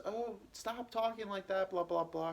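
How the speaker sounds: a hint of a mom's pleading objection; mother protesting. Retelling mother's upset protest.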